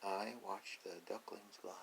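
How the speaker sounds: usual speaking voice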